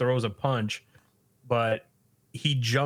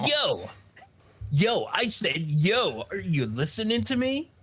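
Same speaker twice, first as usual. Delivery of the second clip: raised-pitch, insistent calling; pestering demand; 7 semitones higher than usual. Retold or imitated. School bully to Takakura who is reading, insistent. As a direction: keep poking for attention, rising to a high question.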